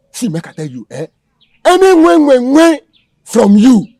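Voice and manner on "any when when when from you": high, loud threat; a menacing shouted warning; angry yell. Acted. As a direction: shout him down. Kidnapper threatens the pastor.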